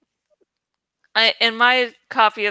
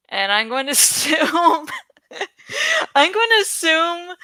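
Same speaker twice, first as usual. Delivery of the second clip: laughing through the words, rising higher; 6 semitones higher than usual. Real, not acted. Vlogger teasing viewers about the series, laughing. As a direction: giggle while repeating the line. Laughs at her own joke.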